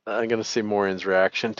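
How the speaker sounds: usual speaking voice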